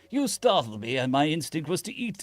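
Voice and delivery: high-pitched upper-class British apology; posh British accent. A narrator's reading. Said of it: "The posh upper-class British accent".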